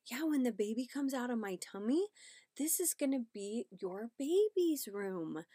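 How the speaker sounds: put-on lifted parent-to-child voice; talking to a toddler. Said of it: "talking to her".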